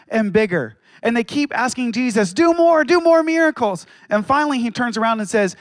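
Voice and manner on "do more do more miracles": demanding crowd. Acted. Crowd demanding more miracles.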